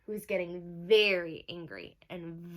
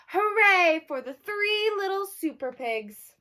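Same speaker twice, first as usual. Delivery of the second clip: high, loud joyful cheer; 9 semitones higher, 8 dB louder than usual. A narrator's reading. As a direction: cheer like the whole town. Cheering the happy ending.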